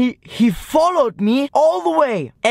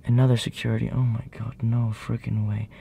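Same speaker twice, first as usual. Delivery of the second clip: low, quiet undertone; under your breath, as another guard appears; hushed aside; 9 semitones lower, 5 dB quieter than usual. Real, not acted.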